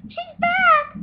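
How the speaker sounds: high, loud play-acted shriek; mock alarm. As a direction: cry out in pretend alarm. Pretend alarm in doll play.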